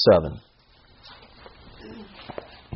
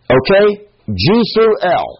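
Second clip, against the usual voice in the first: eager start; 9 semitones higher, 4 dB louder than usual. Retold or imitated. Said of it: "I say".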